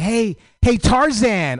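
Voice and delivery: high, imitated man calling out; admirer's catcall. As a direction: call out a compliment as the admirer. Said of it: "this African man was like".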